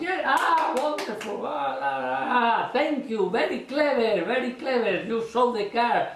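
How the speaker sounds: high, effusive flattery; gushing praise. Shown as a demonstration. Magician to spectator, gushing with praise. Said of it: "when he say".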